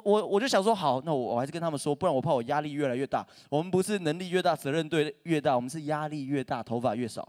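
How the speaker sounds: ordinary talk, nothing marked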